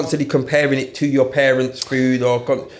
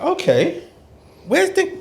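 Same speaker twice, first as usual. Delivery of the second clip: high-pitched. Retold or imitated. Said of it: "And I'm like".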